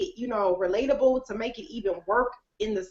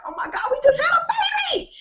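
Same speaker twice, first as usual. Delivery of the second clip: high, thrilled cry of joy; mock thrilled squeal; 5 dB louder than usual. Editor celebrating a finished book with her author, high thrilled squeal. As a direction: squeal like a proud new parent. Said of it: "and I'm like".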